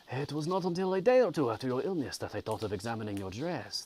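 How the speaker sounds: lilting, badly faked French accent for a concerned gentleman; Mister Kirwin. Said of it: "This is a terrible accent".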